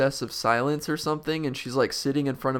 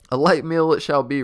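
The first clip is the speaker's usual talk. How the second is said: mild roar; 3 semitones higher, 7 dB louder than usual. Acted. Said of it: "roared the bug".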